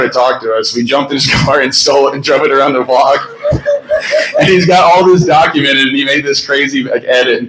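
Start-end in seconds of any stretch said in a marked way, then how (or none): 3.2-4.3 high, breathy laugh; laughing at the memory; real burst of laughter. Real, not acted. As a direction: crack up mid-story. Story of stealing his car.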